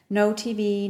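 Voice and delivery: plain reading voice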